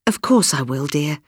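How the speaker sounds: usual speaking voice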